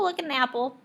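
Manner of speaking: ordinary talk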